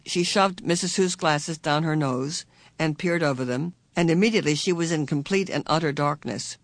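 ordinary delivery

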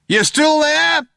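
very loud, high, drawn-out holler; calling out. A narrator's reading. Cop checking on fugitives after the fusillade, calling out. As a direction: holler it slowly across a distance. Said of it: "called one of the cops".

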